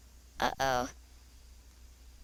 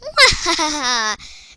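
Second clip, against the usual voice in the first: laughing; 3 semitones higher, 8 dB louder than usual. Real, not acted. Laughing again after remark.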